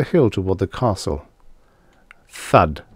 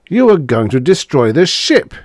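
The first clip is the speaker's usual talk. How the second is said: higher, louder disbelieving shout; incredulous shout; 7 semitones higher, 6 dB louder than usual. Acted. Harvey shouting at Dana in disbelief. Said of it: "he shouted incredulously".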